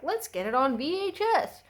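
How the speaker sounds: put-on childlike voice pitched high; younger me. Kid self pleading to get the movie on VHS, voiced high. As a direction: younger self begging to buy the tape on VHS. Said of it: "young me was like".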